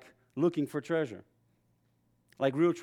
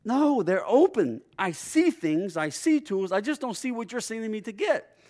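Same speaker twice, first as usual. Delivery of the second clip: put-on bewildered kid; 7 semitones higher, 4 dB louder than usual. Young boy answering his angry stepdad, bewildered high plea. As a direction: insist you can see, confused.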